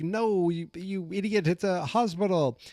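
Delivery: high exasperated correction; Jonathan. Retold or imitated. Friend correcting his clueless buddy, exasperated and high.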